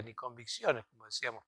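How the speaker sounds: usual speaking voice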